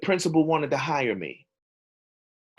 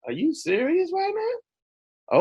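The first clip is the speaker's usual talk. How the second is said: incredulous; 12 semitones higher, 4 dB quieter, slower than usual. Retold or imitated. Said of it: "looking at Jesus like".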